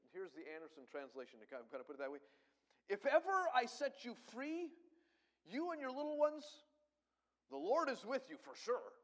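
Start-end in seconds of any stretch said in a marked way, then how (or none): 2.9-9.0 high, loud mocking sneer; sarcastic Pharaoh. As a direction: sneer it as a scornful king. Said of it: "He's saying that kind of sarcastically".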